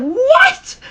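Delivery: very high-pitched mock-shocked squeal; incredulous outburst. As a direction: shoot the voice way up in disbelief. Retold shock at being refused.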